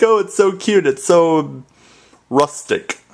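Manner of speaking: high, gushy "Oh, it's so cute, it's so rustic"; gushing imitation. Mimicking people who find "warsh" cute, high and gushing. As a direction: coo it like a fan of the quirk. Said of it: "Some people are like".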